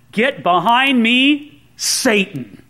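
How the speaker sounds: slow, stern command; stern rebuke. Acted. Jesus turns on Peter after his objection, stern. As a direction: rebuke him slowly, word by word. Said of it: "now Jesus looks real stern".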